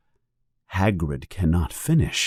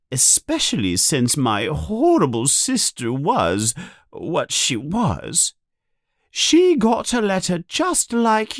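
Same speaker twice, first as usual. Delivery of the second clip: much higher, resentful ranting voice; bitter Aunt Petunia; 9 semitones higher than usual. A narrator's reading. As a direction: spit out years of jealousy. Petunia's long rant, high voice.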